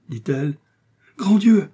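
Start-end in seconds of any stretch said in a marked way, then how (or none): 1.2-1.7 loud, high-pitched cry; sudden shocked outburst; horrified exclamation. A narrator's reading. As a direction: cry out in alarm. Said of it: "s'écria Felton".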